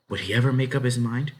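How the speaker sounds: plain reading voice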